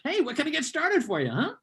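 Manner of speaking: high chirpy barista voice; perky hammed-up barista pitch; relentlessly cheerful barista. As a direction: do an over-cheerful barista greeting. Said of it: "the Starbucks script".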